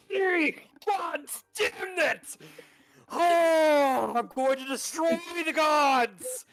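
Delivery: a high, loud, raging shriek; high furious scream in the distance; distant furious screaming. Acted. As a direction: scream your fury at the gods from far off.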